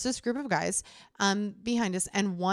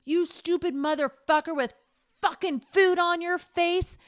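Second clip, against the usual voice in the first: high, loud mock-furious yell; insane rage; 9 semitones higher, 4 dB louder than usual. Woman reliving yelling at a heckler outside the food truck, unhinged yell. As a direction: retell the outburst with manic fury. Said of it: "I went literally insane".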